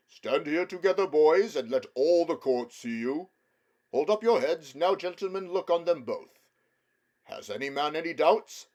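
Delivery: loud, high royal proclamation; a king shows both sons to his court; proud king. A narrator's reading. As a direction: present them proudly to the court. King presents the boys to court.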